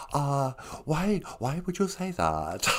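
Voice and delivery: soft, slow mock Chinese accent for the puppet; lightly put-on Chinese accent, quiet. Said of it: "that's kind of a stereotypical accent".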